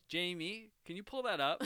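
loud, high podcast host call; Joe Rogan impression. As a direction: call out to the producer loudly. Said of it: "what's Joe Rogan?".

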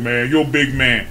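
low imitated cop voice; level with him as a deep-voiced officer; deep, placating cop impression; police officer. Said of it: "So he just said".

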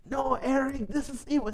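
high, scolding this is evil; angry mother figure. Retold or imitated. Pastor scolding Eric on the phone, high mock-angry voice. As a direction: pitch it up like a scandalized mother. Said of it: "She was so angry".

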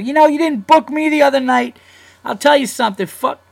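high, loud, unhinged mock rant; mock ranting gunman. Enraged listener ranting at the club booker, high shriek. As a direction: shriek it like a deranged fan.

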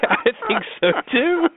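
high, laughing agreement. Real, not acted. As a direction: agree through real laughter. Laughing along at co-host's joke.

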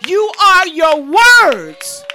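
"words" shouted loud and slow at the peak; shouted slow climax. Acted. Preacher to congregation, loud slow shout on "words". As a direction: hammer "words" home, full voice and slow. Preacher repeats key line louder.

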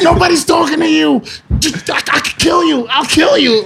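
high, loud, unhinged screaming threats; spazzing out. Retold or imitated. Will Ferrell's character raging at Mark Wahlberg, high furious yelling. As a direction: lose it completely and yell.

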